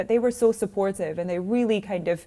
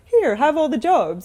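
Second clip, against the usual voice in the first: high, slow, loud handing-out voice; mock generous offer; 7 semitones higher, 5 dB louder, slower than usual. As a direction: offer it like a lavish gift. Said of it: "people aren't just gonna be like".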